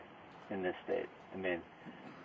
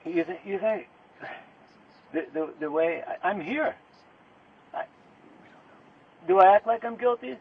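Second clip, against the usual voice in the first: real indignant outburst; 8 semitones higher, 4 dB louder than usual. Real, not acted. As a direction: voice climbing higher and louder into a real indignant question. Suspect challenges detectives, rising agitation.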